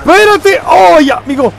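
high, loud yelling under attack; panicked shout. Real, not acted. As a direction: yell high in a gamer's panic. (gritos agudos de pánico)

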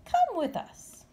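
high, slow, soothing; reassuring mother bat. Acted. Mother Bat calming Stellaluna, reassuring. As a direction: coax her gently into the dark.

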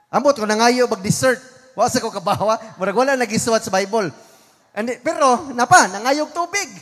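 loud, driving sermon climax; preaching at full force. Acted. Preacher to congregation, building to a loud preaching climax. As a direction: hammer the story home to the congregation.

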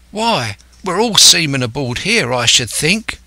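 put-on high-pitched young sailor; young lad Dick. Lad objecting to Silver, piping up youthfully. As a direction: pipe up as the junior hand.

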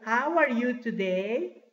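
slow, stretched question with rising end; slow model question. Shown as a demonstration. Teacher asking class how they are, slow model question. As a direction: ask it slowly for learners.

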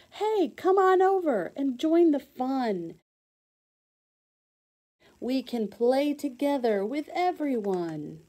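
friendly shout. Acted. Scooter calling a left-out kid to join, friendly shout. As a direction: cheerfully wave a lonely kid over.

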